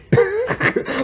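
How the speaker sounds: sudden high laugh in disbelief; high burst of laughter. Real, not acted. Vlogger mocking the cops' lazy assumption, high burst of laughter. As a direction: break into a squeal of laughter.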